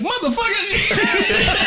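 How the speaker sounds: drawn-out high mock curse; mock dad cursing. Imagining what dad wanted to say, slow mock curse. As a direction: stretch the curse out laughing. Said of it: "Did you wanna be like".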